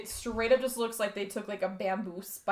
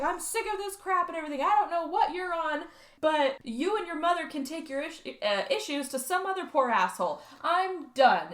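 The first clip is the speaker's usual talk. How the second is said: higher, fast ranting dad; mock furious dad; 5 semitones higher than usual. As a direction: imitate the dad snapping at his daughter. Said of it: "he's just like".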